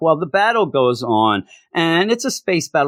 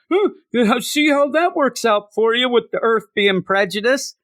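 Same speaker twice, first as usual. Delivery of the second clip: mocking quote; 9 semitones higher than usual. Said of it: "only to have Brian Michael Bendis".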